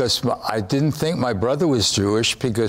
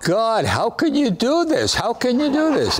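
exasperated plea; 9 semitones higher than usual. Retold or imitated. Crying out to God in disbelief.

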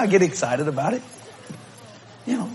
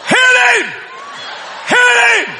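cheering shout; much slower than usual. Retold or imitated. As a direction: yell at the screen. Cheering at Rocky movie.

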